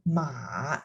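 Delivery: "ma" dipping low; low third tone. Shown as a demonstration. Teacher explaining the word for horse, low "ma". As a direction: drop "ma" down to the bottom. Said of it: "And the third tone".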